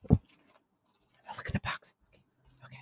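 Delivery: soft unvoiced whisper; nervous whisper. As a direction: whisper it to yourself. Nervous self-talk.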